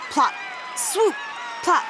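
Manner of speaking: usual speaking voice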